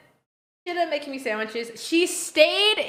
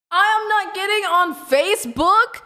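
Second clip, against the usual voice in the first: louder, higher flat refusal; a louder mock refusal to the neighbor; firm refusal; 6 semitones higher, 5 dB louder than usual. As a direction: shut it down firmly. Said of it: "I was like".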